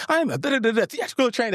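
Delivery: a hammed-up British accent, high and posh-sounding; mock British accent. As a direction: imitate a stuck-up British stage actor. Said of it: "goes into this, like, British accent".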